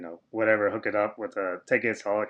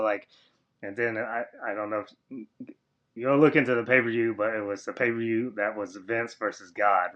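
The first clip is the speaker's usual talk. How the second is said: usual speaking voice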